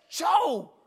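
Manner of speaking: high, strained and insistent; insistent call. Retold or imitated. Hunter tries buddy a second time, strained insistent call. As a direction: push the name harder, squeezed high.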